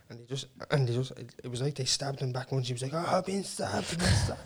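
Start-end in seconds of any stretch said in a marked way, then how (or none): 2.9-4.4 high, hushed wail of a victim; retold cry for help, kept small; mock wounded cry. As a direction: imitate a stabbed man wailing, high but soft. Voicing the stabbed man's cries.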